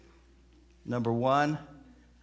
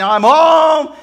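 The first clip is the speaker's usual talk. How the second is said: big, high holler through the house; acted husband's loud arrival shout; yelling; 13 semitones higher, 19 dB louder than usual. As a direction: call out at full volume, stretching HOME.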